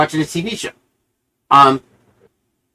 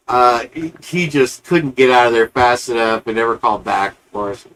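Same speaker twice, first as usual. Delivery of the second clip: ordinary delivery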